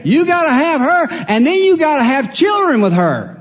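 loud, high preaching push; preaching emphasis. Acted. Preacher hammers the catch of the deal to the congregation, loud and high. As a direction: drive the catch home, shouting high.

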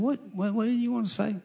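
put-on soft and high, kneeling to a small boy; gentle voice to a child. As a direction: coax him kindly, like a little buddy. Coaxing a four-year-old.

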